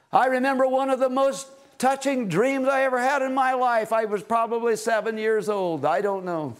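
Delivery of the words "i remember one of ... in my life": ordinary delivery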